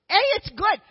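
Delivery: high, loud burst of delight; excited exclaim. Real, not acted. Speaker tells the audience about eating snails, high and excited. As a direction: jump up bright and loud.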